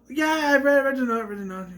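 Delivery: high, hurried repetition to talk over them; drowning someone out. Retold or imitated. Girl covering up about reading the note, drowning out the question. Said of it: "kind of drown out the note".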